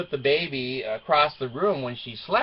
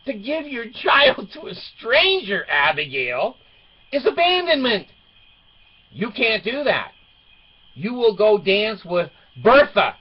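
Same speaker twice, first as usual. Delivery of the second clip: raised, emphatic dad lecture; retold scolding; 8 semitones higher, 5 dB louder than usual. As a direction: lay down the law to a daughter, high and emphatic. Dad retells scolding his daughter.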